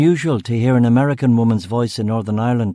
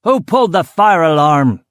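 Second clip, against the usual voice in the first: loud, high-pitched angry shout; screamed; 6 semitones higher, 7 dB louder than usual. A narrator's reading. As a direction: scream the question across the factory. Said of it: "Delorean screamed".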